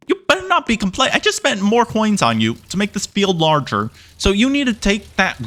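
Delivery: high, fast mock scold at the cow. Scolding the picky cow in a high, fast mock voice. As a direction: tell the cow off, hammed up.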